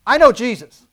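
loud and high, a bold announcement; bold declaration. Shown as a demonstration. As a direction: declare it proudly to the whole room. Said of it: "just walk in and say".

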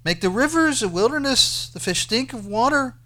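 loud, high rhetorical challenge; forceful declaiming. Acted. Quoting God's challenge, raised voice.

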